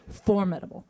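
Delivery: "formidable" stressed on FOR; stress on first syllable. Retold or imitated. Coach insisting to the actress, "formidable" stressed on FOR. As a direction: say "formidable" as FOR-midable. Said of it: "he's going".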